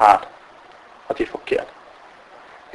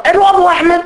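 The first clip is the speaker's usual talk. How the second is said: surprised question. Retold or imitated. Caller asks in surprise.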